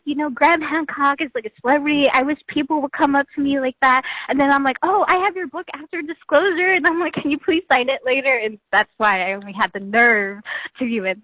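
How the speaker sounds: usual speaking voice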